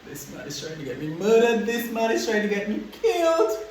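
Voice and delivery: building to a high-pitched wail; hammed-up, escalating panic; panicked. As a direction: start contained, climb into a panicked cry. Acted panic about being endangered.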